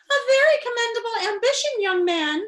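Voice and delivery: put-on high, loud little old lady; squeaky little old lady voice; small old lady voice. Shown as a demonstration. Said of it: "said a small voice from across the cell".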